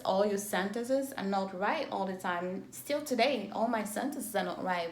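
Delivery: ordinary talk, nothing marked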